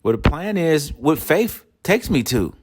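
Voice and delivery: ordinary delivery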